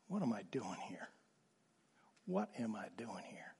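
hushed, breathy what am I doing here; whispered despair. Retold or imitated. A pastor in the back row of the choir, whispering in quiet despair.

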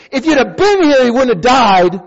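high, loud pleading reproach; lightly voiced accusing friend; grieving friend. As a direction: cry it out as the grieving sister. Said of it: "his closest friend comes to him and said".

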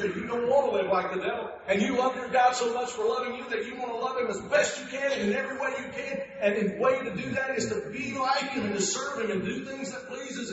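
high, loud, driving sermon climax; preaching at full force. Acted. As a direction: build the fire of the pulpit.

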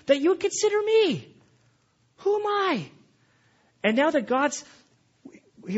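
loud, high, impassioned preaching; preacher crying out a heartfelt prayer to God; full-force preaching. Acted. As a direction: raise the voice and pour it out in praise.

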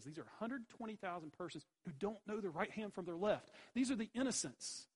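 rising loud, high, fiery preaching; preacher driving home who the innocents are; preaching climax. Real, not acted. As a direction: build it up to a roar.

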